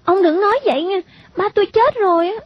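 put-on high-pitched child's objection; protesting little girl. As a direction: protest to the grown-up in a high young voice.

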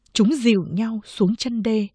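usual speaking voice

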